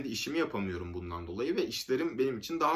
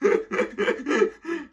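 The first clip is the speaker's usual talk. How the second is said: laughing; 13 semitones higher, 6 dB louder than usual. Real, not acted. Laughing at thieves' messages.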